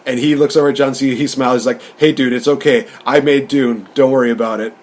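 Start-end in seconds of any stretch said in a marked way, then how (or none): none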